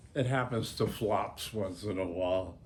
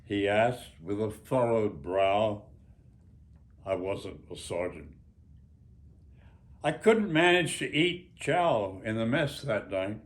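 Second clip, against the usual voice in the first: memoir reading; 4 semitones higher, 7 dB louder than usual. Acted. Author reads his memoir aloud.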